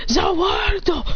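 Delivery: drawn-out high attack call; battle cry. Acted. Fighter freezes time as he rushes in, battle cry. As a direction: call out the move, high and stretched.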